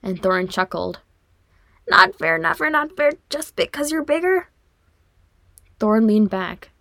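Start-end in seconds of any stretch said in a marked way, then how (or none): 1.9-4.5 put-on high, whiny kid protest; childish whine. A narrator's reading. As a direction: whine like a squashed little brother. Little brother squirming in headlock.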